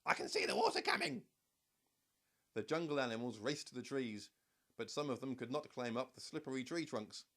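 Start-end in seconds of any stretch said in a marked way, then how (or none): 0.1-1.3 very high, louder bird voice; squawky cockatoo. A narrator's reading. Cockatoo warning from above, high and squawky. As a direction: chirp it like a cockatoo. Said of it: "squawked the cockatoo".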